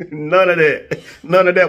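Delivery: high, laughing insistence; laughing refusal. Real, not acted. Laughingly refusing haunted experiences.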